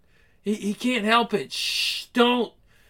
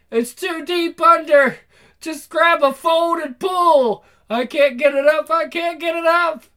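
high, loud frantic yelling; frantic shouting; 6 semitones higher, 5 dB louder, slower than usual. Acted. Team frantically shouting at the struggle. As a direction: shout in a panic.

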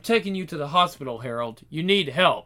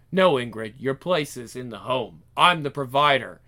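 deep stern patriarch; 8 semitones lower than usual. Acted. Husband asserting authority over wife.

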